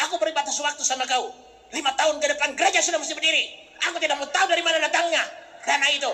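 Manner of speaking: fast, defiant ultimatum; challenging God. Retold or imitated. Preacher giving God an ultimatum, fast and defiant. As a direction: lay down terms boldly and quickly. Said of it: "sama Tuhan Allah tantang".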